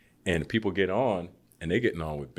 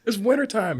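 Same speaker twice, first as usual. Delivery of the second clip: very high, laughing-edged disbelief; bewildered complaint; 4 dB louder than usual. Retold or imitated. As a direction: whine it through half a laugh. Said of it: "I'm like".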